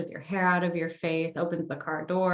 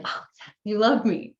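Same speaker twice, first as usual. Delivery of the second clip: breathed 'oh, yes', then lifted and louder; delighted gratitude; 4 semitones higher, 5 dB louder than usual. Shown as a demonstration. Coach thanking the universe for a parking spot, delighted. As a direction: celebrate a small win.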